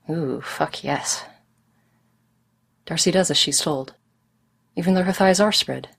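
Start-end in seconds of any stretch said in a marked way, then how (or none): 0.1-1.2 breathless whisper. Acted. Darcy aroused at command.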